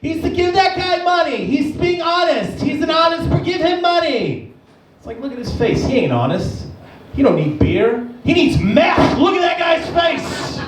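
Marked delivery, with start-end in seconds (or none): 0.0-4.5 high-pitched mock-gushing crowd; gullible admirers. People falling for an honest beggar's sign, mock-gushing. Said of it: "People love that shit. They're like". 8.2-10.6 high-pitched comic outburst; exasperated shout. Real, not acted. Comedian correcting gullible donors, exasperated shout.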